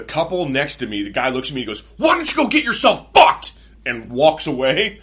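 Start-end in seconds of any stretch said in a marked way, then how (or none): 2.0-3.5 high, loud mock-angry yell; imitated outraged shouting, pitched way up; angry retort. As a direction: yell it like a fed-up stranger. Angry stranger snaps at the teller.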